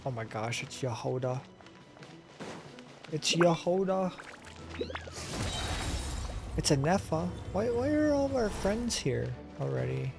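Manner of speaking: usual speaking voice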